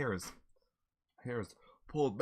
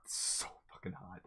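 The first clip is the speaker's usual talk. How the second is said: whisper; 3 semitones lower, 11 dB quieter than usual. Real, not acted. Eating hot chicken.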